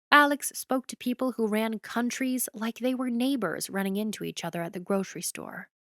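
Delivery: usual speaking voice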